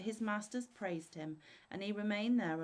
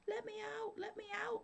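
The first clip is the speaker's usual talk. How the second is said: very high, muffled little trapped-spirit voice; tiny spirit squeaking from inside a bottle; smothered cry; 3 dB quieter than usual. A narrator's reading. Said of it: "it cried anew".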